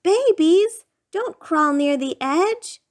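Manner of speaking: high loud warning on babies; yelling. Acted. Parent yelling at babies near the ledge, loud high warning. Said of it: "you yelled".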